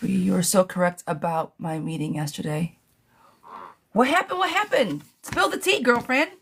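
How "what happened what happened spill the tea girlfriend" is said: high, eager gossip voice; thrilled to hear the news; excited. Real, not acted. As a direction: squeal for the tea.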